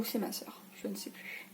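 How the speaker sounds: whispered aside to herself; mumbled whisper of doubt. Real, not acted. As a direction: drop to a breathy whisper, half to yourself. Unsure aside about sibling.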